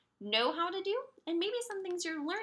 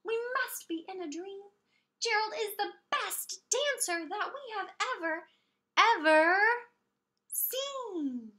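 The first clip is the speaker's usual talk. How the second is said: excited shout; 5 semitones higher, 4 dB louder than usual. Acted. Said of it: "They shouted".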